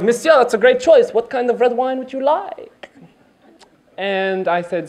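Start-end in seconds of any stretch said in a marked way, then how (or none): 0.0-2.9 higher, snooty waiter voice; mock posh sommelier. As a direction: play the fancy sommelier. Imitating the sommelier, laughing.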